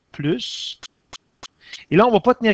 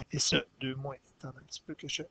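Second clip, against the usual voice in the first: much softer, under the breath; muttered to herself; 3 semitones lower, 14 dB quieter, much faster than usual. Real, not acted. Teacher mutters the formula to herself while fixing the board. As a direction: murmur it while writing on the board.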